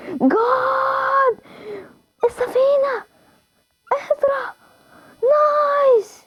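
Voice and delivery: high, stretched-out squeal of joy; excited shriek. Real, not acted. Gamer spots the ship and squeals in excitement.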